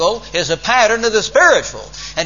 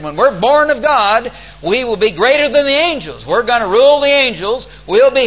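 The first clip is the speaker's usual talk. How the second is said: raised preaching voice; 7 semitones higher, 5 dB louder than usual. Retold or imitated. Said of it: "He said".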